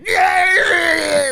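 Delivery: high, drawn-out wailing yell; crying shout. Retold or imitated. Jerry wailing down the phone to his friend at night. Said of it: "And he's like".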